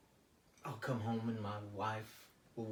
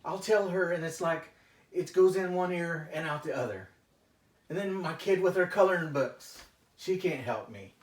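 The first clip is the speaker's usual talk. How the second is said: frustrated venting; 6 semitones higher, 8 dB louder than usual. Acted. Client vents growing frustration.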